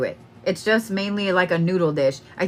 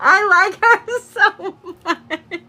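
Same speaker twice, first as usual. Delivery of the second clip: high, squealing through laughter; words stretched and broken by laughing; 9 semitones higher, 3 dB louder, much slower than usual. Real, not acted. Reader gushing over the client's grandmother, laughing. Delighted by the spirit, overcome laughing.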